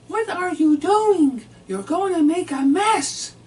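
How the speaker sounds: high, loud, slow scolding wife voice; mimicking his wife. Husband mimics his wife scolding him at dinner, high and loud. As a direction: squeal it out like a shocked wife. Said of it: "Lucille says".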